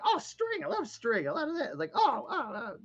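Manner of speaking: very high, fast squeaky creature chatter; gleeful tiny creature digging through a bag; excited little goblin. Acted. Creature delighted by string.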